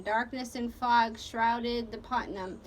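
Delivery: plain reading voice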